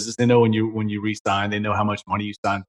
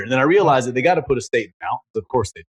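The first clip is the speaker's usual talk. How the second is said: normal talking voice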